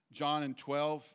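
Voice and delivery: usual speaking voice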